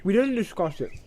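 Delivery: talking through a mouthful; mouth full. Acted. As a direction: speak round a bite of food. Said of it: "with his mouth full".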